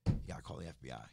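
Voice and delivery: low, grave warning; low serious advice. Retold or imitated. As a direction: lay it down gravely, like a veteran.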